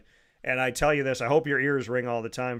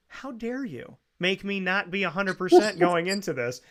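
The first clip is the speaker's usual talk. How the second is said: mock outrage; 6 semitones higher than usual. Joking at teams that lost.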